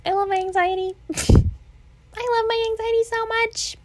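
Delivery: high, sing-song sarcastic gushing. Real, not acted. Sarcastic sing-song about own anxiety.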